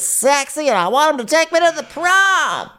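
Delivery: high, hammed-up fifties teen, climbing to a squeal; nineteen-fifties talk. Warning a friend off a date, mock fifties teenager. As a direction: stretch it like an old-movie sweetheart. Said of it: "talking like she's from, like, nineteen fifty-seven".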